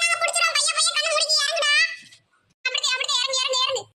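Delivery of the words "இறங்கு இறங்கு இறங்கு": ordinary talk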